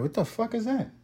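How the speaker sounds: high, soft puzzled question; baffled. Retold or imitated. Asking his friend about a new term, high and soft. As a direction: ask it lightly high and confused. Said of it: "I was like".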